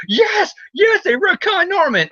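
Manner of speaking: very high mock-ecstatic cheer; hammed-up jubilant cry; imitated fan elation. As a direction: squeal like an overjoyed fan. Imitates overjoyed fans.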